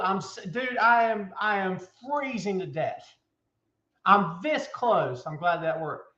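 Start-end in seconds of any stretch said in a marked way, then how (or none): none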